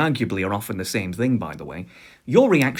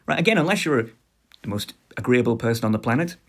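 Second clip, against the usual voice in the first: normal talking voice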